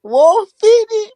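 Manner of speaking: very high, slow, wailing falsetto; falsetto hype shout-out. Pretend. Hyping up a viewer on the stream with a high falsetto wail. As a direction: stretch it out like a hype man's wail.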